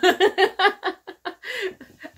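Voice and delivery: high, loud giggling burst; real laughing fit; loud high laughter. Real, not acted. Laughing at the sound she makes.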